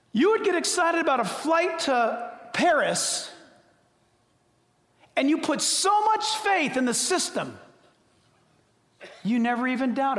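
loud, high preaching shout; shouting preacher; preaching at full force. Real, not acted. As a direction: hammer the point home.

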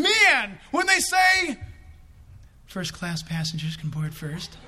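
high, loud, laughing glee; excited shout. Retold or imitated. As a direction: crow with childish delight. Imagining boarding first.

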